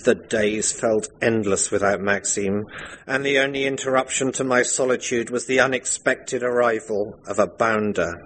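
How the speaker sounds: normal talking voice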